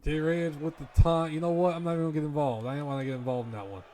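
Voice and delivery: loud, excited play-by-play call; wrestling commentator. Acted. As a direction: hype up the big moment ringside.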